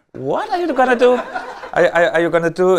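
high-pitched mock disbelief, stumbling over the question; incredulous reaction. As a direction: act out being floored by a crazy plan, pitch jumping up. Acted disbelief at invasion plan.

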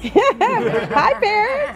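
laughing greeting. Real, not acted.

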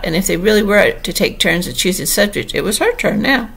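usual speaking voice

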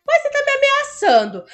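high mock-offended complaint; mock outrage. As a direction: protest in hammed-up offense.

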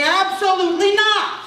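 high, loud absolutely-not; emphatic refusal. Retold or imitated. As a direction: slam the door on the idea. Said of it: "more emphatic than the term".

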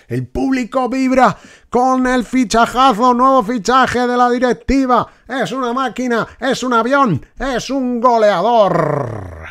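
high, loud hype commentary; commentator voice. Pretend. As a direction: hype the striker like a TV announcer. Commentator praising new signing.

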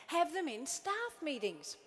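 ordinary delivery

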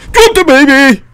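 high, very loud yell; real shout. Real, not acted. Player orders game woman to drop baby, shouting. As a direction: shout the order at full volume.

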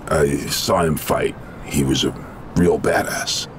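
very low, sombre delivery; subdued condolence. Acted. Consoling a grieving comrade, low and sombre. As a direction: pay quiet respect in a deep, low register. Offers condolence over dead fighter.

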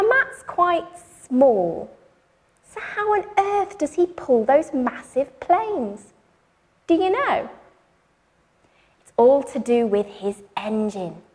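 ordinary delivery

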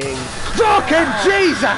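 slow, loud shocked outburst; startled shout. Real, not acted. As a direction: yell it out in shock. Jump scare in horror game.

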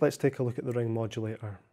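usual speaking voice

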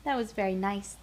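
ordinary delivery

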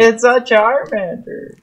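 high, drawn-out delighted; excited. Real, not acted. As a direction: gasp at the find. Excited spotting Charmander.

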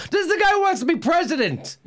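high-pitched, exasperated disbelief; incredulous outburst. Real, not acted. Host reacting to a candidate's tweet, incredulous.

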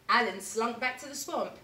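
ordinary delivery, nothing marked

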